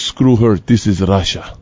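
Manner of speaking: put-on a lightly low Russian accent; a hint of gruff Russian gangster; Igor's Russian voice. Igor brushing off the teacher, light Russian accent. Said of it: "he goes".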